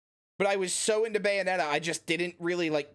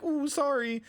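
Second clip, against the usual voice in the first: sheepish cowboy; 8 semitones higher than usual. Retold or imitated. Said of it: "he goes full, like, cowboy hat in hand".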